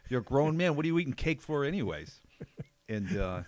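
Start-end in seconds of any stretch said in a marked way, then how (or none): 0.1-2.2 loud, raised mock dressing-down; higher, louder teasing rebuke; mock scolding. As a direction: berate a friend in playful outrage. Acted scolding of a cake-eating friend.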